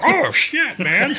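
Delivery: high startled yelp; high surprised curse. Retold or imitated. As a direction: blurt it out startled. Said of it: "I'm like".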